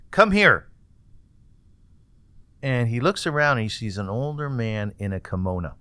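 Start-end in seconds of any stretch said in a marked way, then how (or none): 0.1-0.7 high, loud call across the subway car; yelling out. A narrator's reading. Old man calling loudly to the drunk: come here. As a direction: call him over with a loud, high shout. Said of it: "a voice yelling out".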